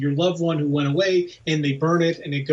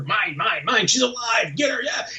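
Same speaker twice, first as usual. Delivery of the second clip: greedy mock clamor, climbing high; frenzied dead; 6 semitones higher than usual. Dead people mobbing the living girl, frenzied and greedy. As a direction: build into a high, grabby mob cry. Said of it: "dead people who are like".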